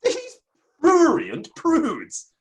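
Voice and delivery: indignant high outburst, words stretched out slowly; outraged. Acted. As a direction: spit out the insult in theatrical outrage. Outraged actor denouncing critics.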